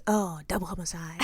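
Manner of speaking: low, soft deadpan drop; muttered aside. Retold or imitated. Quoting the girl in the background, muttered aside. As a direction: toss it off quietly, imitating a bystander.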